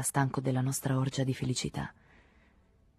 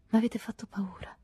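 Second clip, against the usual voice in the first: frightened whisper; 7 semitones higher, 3 dB quieter than usual. A narrator's reading. Frightened woman confesses.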